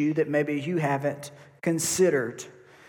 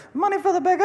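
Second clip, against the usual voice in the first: high-pitched put-on beggar's cry; 12 semitones higher, much faster than usual. Beggar asking the disciples for alms, high put-on voice. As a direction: call out for alms in a comic high voice. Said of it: "I don't know if that's how he sounded".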